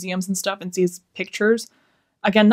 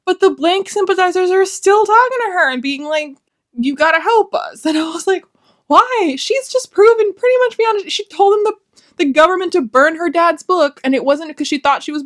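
higher, louder incredulous rant; exasperated rant; 10 semitones higher, 4 dB louder than usual. Real, not acted. Reviewer venting about the plot, high exasperated rant. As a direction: pour out the disbelief at full tilt. Ranting about characters' choices.

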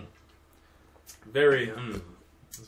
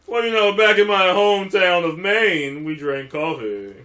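mock Mainer; 6 dB louder, slower than usual. Playing interviewee from Maine.

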